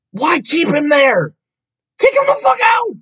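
high-pitched incredulous outburst; exasperated yell. Acted.

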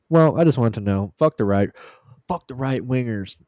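ordinary talk

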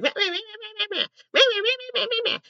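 high, nasal-free mocking meh meh meh, whiny mimicry; mocking dad. Mocking the dad's nagging from the other go-kart, high taunting meh meh. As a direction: parrot the dad back in a shrill mocking bleat. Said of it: "my dad is in a different go-kart, and he's like".

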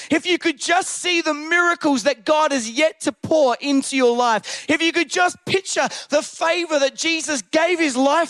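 full-force, rolling sermon climax; driving, fervent preacher's delivery; impassioned preaching. Real, not acted. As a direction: build insistent phrases to rouse a congregation.

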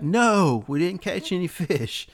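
high-pitched holler back to shore. Retold or imitated. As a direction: call it back across the water. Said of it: "they holler back".

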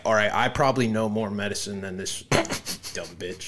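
a touch of a smug dad at Home Depot; know-it-all dad. Retold or imitated. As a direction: friend mocking doctors in a low, smug dad voice. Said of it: "You sound like a dad at a Home Depot".

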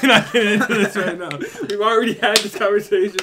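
laughing. Real, not acted. Laughing refusal.

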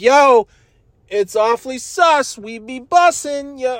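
put-on a dad hamming up cool teen talk; mock teen slang. Dad out the car window, mocking teen slang in a high voice. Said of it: "and I'm like".

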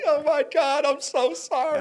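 high, loud, sobbing apology; high tearful cry. Retold or imitated. Crying to God in remorse, high tearful apology. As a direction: plead through the tears. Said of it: "I'm just like".

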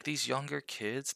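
ordinary delivery

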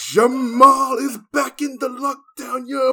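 high, loud, building hype; hype host. Pretend. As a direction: hype the crowd up. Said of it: "You ready for the remix?".

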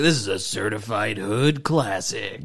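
slow, low hype-man voice. Retold or imitated. Teasing a friend by mimicking a DJ, slow and low. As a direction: lean back and drawl it like a proud DJ. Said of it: "You're like the guy that's like".

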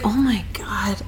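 imitated hushed exasperation; breath-only aside, retold; whispered dismay. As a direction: drop to a whisper, as if appalled. Retold reaction to friend's call.

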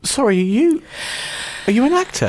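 high, sarcastic fake surprise; mock surprise. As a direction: act amazed, teasingly. Mock surprise at cohost being actor.

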